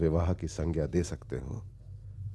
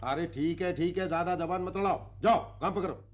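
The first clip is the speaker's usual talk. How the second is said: high-pitched bossy brush-off; irritated dismissal; 10 semitones higher, 3 dB quieter than usual. Acted. Site boss sending woman to work, irritated. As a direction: cut off the back-talk.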